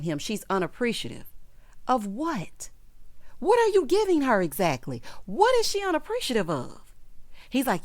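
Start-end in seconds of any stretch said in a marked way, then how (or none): 1.9-6.8 high-pitched, incredulous questioning; indignant challenge. Real, not acted. Host takes on his complaint about her, indignant. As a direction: demand an answer, pitch shooting up.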